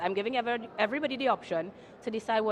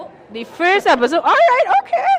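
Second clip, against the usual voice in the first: laughing; 9 semitones higher, 13 dB louder than usual. Real, not acted. Host laughs at the teaser.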